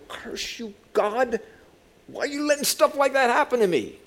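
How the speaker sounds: high-pitched, hammed-up protest; theatrical railing at heaven; mock cursing at God. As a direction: cry out in complaint without full volume. Acted complaint shaken at God.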